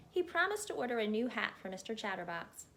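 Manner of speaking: plain reading voice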